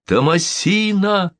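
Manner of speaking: high, slow calling out; crying out. Acted. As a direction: cry the name out in joy. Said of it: "вскричали разом".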